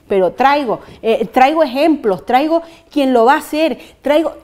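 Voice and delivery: machacando traigo cada vez más fuerte; énfasis creciente. Real, not acted. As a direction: insiste en voz alta repitiendo traigo. Alcaldesa insiste con énfasis. (repite traigo con fuerza y convicción)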